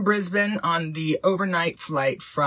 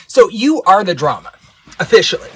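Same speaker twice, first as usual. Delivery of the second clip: loud, forceful proclamation; emphatic verdict; 4 semitones higher, 13 dB louder than usual. Real, not acted. Host officially rules against letter writer, emphatic verdict. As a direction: proclaim the judgment at full volume.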